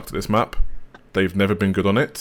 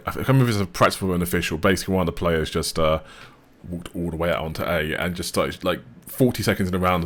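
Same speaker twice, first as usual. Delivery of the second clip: ordinary delivery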